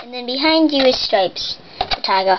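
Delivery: ordinary talk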